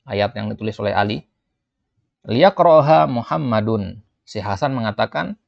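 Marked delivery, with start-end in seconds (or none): 2.3-4.0 raised, slow dialogue reading; acted student line. Hasan tells the teacher Muhammad should read, louder. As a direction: call out the classmate's name.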